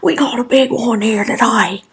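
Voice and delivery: excited Australian wildlife host impression; Steve Irwin. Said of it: "parts of his show where he does go like".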